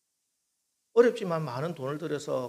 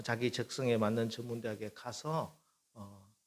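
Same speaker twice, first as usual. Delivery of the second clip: ordinary talk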